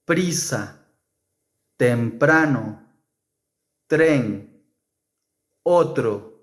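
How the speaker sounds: brazo, crema, tren with a single tapped r; soft r. Shown as a demonstration. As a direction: tap the r once after the consonant. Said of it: "Whenever the letter R is after these consonants".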